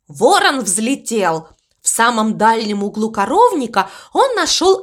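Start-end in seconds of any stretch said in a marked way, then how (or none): none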